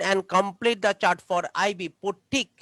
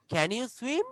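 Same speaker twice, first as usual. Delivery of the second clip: "Can you swim?" rising up on "swim"; rising question; 3 semitones higher, much slower than usual. Shown as a demonstration. Teacher showing how a question rises: rising tune. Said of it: "কথাটা উঁচার দিকে উঠবে".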